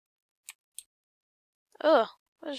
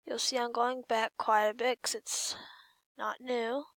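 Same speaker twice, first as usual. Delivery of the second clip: ordinary delivery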